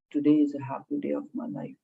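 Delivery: quiet, flat, no expression; low flat voice. Shown as a demonstration. Trainer showing a flat, quiet read. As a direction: say it flat, as if you don't mean it. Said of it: "ekdam low voice".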